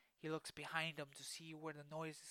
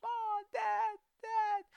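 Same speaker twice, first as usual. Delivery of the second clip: much higher, louder frantic cries for Ma; scared screaming; 12 dB louder, much slower than usual. Acted. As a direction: call out lost and terrified. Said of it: "keeps screaming".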